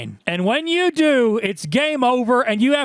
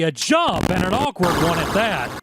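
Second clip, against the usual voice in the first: ordinary talk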